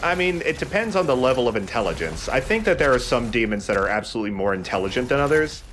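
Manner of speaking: ordinary talk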